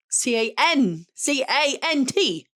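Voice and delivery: slow letter-by-letter C A N T; spelling out. Shown as a demonstration. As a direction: spell C A N T out one letter at a time. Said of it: "say can't".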